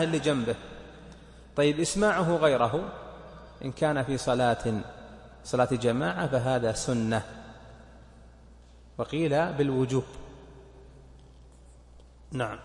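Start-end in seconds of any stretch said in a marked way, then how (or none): none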